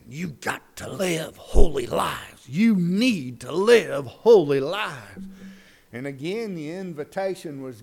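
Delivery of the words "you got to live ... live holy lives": slow, deliberate preacher warning; urging preacher. Acted. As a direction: lean on every word to urge them. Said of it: "he urged the congregation".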